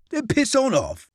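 high mocking laugh into the words; laughing dismissal. A narrator's reading. Quartermaster laughs Francois off, mocking. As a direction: laugh him off scornfully.

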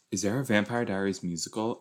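normal talking voice

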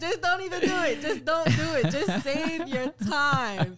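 high, loud, laughing insistence; emphatic plea. Real, not acted. Pleading with listeners not to stray, loud and laughing.

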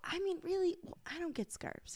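hushed whisper aside. Real, not acted. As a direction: confide it under your breath. Confiding dislike of scarves.

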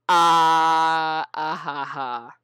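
long loud "ah" into mock laugh; drawn-out ah, then fake ha-ha; imitated knowing reaction. Writer imitates readers getting the reference, mock laugh. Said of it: "everyone who did get it and read the fanfic went".

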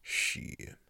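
under-breath whispered curse; whispered dread at another invite; whispered shit. Retold or imitated. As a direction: mutter it under your breath in dismay. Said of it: "And I'm like".

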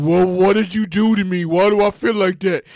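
low growly talking-stomach voice; complaining stomach. Acted. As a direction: groan it as an aggrieved upset belly. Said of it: "your stomach's all like".

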